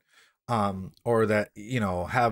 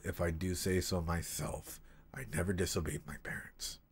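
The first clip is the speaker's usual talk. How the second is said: story reading; 4 semitones lower than usual. Acted. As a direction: read it gently and let it fade nearly to a whisper. Rereading the same passage.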